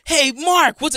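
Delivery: a bright imitated hello; imitating a friendly boss greeting; mock friendly greeting. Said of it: "You don't really show up and go".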